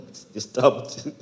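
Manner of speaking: real laugh in the words. Real, not acted. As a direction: say it while chuckling at your own slip. Laughs at own stumble on word.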